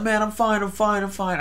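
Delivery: high-pitched insistence; retold reassurance. Calming the panicked stranger, retold.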